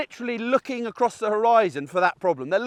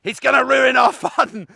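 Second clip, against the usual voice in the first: laughing mock complaint; 6 semitones higher, 7 dB louder than usual. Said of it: "they're like".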